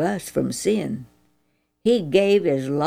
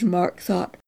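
ordinary delivery

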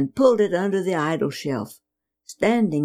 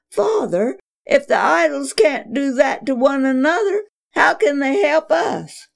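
tearful plea; 8 semitones higher than usual. Acted. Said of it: "Braddock sobbed".

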